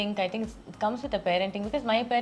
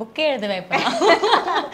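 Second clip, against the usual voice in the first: laughing, louder and higher; 7 semitones higher, 10 dB louder, much faster than usual. Real, not acted. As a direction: laugh through the words. Joking about red flag count.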